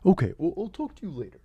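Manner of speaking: quick, quiet sign-off in a put-on voice; Neil deGrasse Tyson. Scientist sent away says goodbye, soft impression. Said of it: "Neil, can you go".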